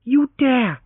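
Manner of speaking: high, loud indignant cry; girl threatens the hero, outraged; threatening. Acted. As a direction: warn him off, outraged. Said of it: "she threatened him".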